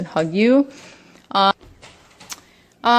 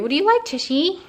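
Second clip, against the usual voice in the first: higher pet voice; 8 semitones higher than usual. Real, not acted. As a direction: ask the cat sweetly. Asking the cat.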